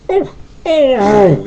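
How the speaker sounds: put-on high child's yelp; child's startled yell. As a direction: cry out like a startled little boy. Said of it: "yelled the little boy".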